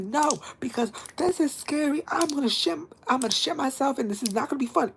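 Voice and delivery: high-pitched mock panic; retold panicked protest. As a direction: whine it high, acting out fear. Retells frightened protest to friend.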